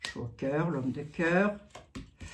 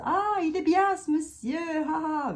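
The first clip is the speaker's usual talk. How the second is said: mock gushing admirer, much higher; mocking turncoat; 11 semitones higher than usual. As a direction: sneer at the fake praise in a high sing-song. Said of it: "tout le monde retourne sa veste".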